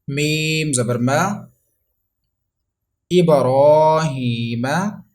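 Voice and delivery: slow, loud Quran spelling-out, syllable by syllable; slow spelling drill. Shown as a demonstration. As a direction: spell each letter slowly for pupils to repeat. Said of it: "Revise after me".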